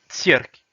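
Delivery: cirque said loud, ts then rolled r; loud model word. Shown as a demonstration. Teacher announces the next word cirque, loud. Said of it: "Donc cirque".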